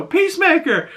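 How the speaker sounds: high-pitched imitation of a woman calling; lady fan. Lady calling to Peacemaker from a car window, high fan voice. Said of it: "lady rolls down the window".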